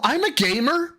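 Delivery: high put-on voice; lightly voiced proud gamer; imitating Phil. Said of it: "Hi, this is Phil Burnell".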